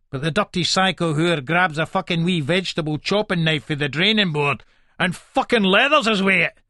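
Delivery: usual speaking voice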